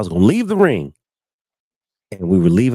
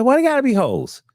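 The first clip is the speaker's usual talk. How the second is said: indignant protest. Retold or imitated. Protesting his fiancée's accusation.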